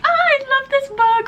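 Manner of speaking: put-on high childlike squeal; gushing little-girl voice; little kid self. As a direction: voice your excited inner child. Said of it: "my little inner child was so happy".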